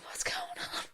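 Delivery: hushed, unvoiced aside to a mate; confused student whispering to a mate; whispered confusion. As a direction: whisper it, lost in class. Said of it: "you're sitting there going".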